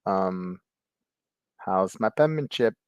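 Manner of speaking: put-on Italian accent. Italian pen pal asks to be friends, mock Italian accent. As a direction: imitate an Italian pen pal, stretching the greeting. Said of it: "read it in his Italian accent".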